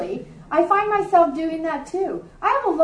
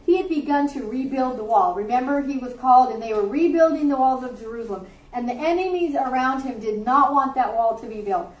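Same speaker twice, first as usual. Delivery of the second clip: ordinary talk, nothing marked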